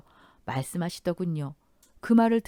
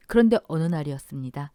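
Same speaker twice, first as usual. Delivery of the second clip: ordinary delivery, nothing marked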